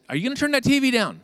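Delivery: high, loud, rushed demand; fired-up snapping. Retold or imitated. Husband fed up with the loud TV, high irritated outburst. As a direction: snap it out, fed up with the noise.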